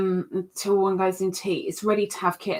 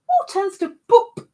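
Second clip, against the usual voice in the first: much higher, louder repeat of the punchline; 11 semitones higher, 6 dB louder than usual. Shown as a demonstration.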